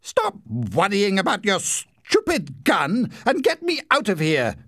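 man stuck in mud yelling at his brother; angry shout. A narrator's reading. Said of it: "shouted Larry angrily".

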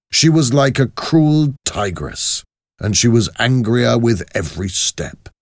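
ordinary delivery, nothing marked

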